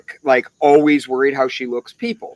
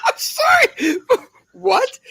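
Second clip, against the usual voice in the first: real high laughing fit; laughing so hard the words squeak; slower than usual. Real, not acted. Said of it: "bursts laughing".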